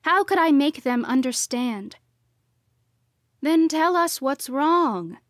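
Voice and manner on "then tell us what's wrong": higher, louder, slow demand; a worried father insisting. A narrator's reading. Father demanding his daughter explain, slow and loud. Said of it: "Dad demanded".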